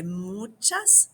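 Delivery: Muchas gracias drawn out slowly; slow teaching demo. Shown as a demonstration. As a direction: model each syllable for the class. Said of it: "You say".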